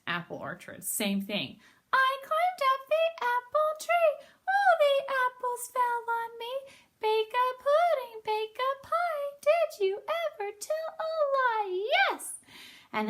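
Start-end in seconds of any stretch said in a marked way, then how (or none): 1.9-11.9 very high, slow bird chant; sing-song it up high like a bird. Acted. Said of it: "I like your bird voice". 11.9-12.4 very high squeaky bird voice. Acted. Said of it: "I like your bird voice".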